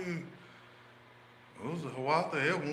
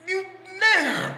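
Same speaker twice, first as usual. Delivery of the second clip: much higher, louder and slow outraged screech; mock shriek; 7 dB louder than usual. Friend shrieks at confession.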